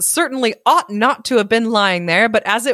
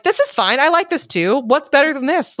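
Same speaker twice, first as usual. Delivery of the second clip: smug contented voice; 7 semitones higher than usual. Retold or imitated. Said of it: "Going like".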